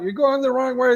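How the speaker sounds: high-pitched imitated shouted warning; mock screaming. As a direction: holler it up high. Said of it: "they're screaming".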